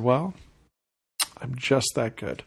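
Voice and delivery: deadpan, slow and soft brag; straight face. Retold or imitated. Seller to puzzled neighbour, a slow deadpan brag. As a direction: play it cool and unbothered, a quiet boast. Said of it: "said it with a straight face".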